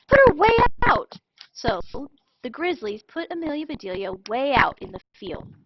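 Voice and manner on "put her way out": high, loud angry shout. Acted. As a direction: yell it at the coach, fed up. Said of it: "they shouted".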